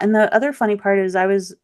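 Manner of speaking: normal talking voice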